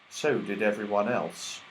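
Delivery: ordinary delivery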